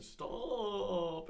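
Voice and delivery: put-on high, whining stop like a crying kid; a little boy on the verge of tears; whiny little kid. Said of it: "And he would be like".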